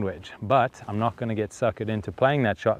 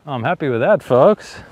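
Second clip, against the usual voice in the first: excited; 4 semitones higher, 9 dB louder than usual. Real, not acted. Delighted with a close approach.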